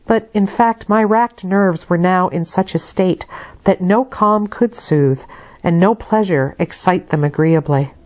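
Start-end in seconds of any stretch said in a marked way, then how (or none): none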